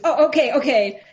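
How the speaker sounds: high, loud flustered agreement; panicky voice. Retold or imitated. Retold teenager agrees to police request, in a panicky voice. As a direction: imitate a panicked kid hastily giving in. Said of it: "this sort of panicky voice".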